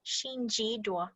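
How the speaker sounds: slow, soft "Xin ji duo." syllable by syllable; very clear pronunciation. Shown as a demonstration. Teacher repeating it for everyone to learn, slow clear demonstration. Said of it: "if you wanna pronounce it very clearly".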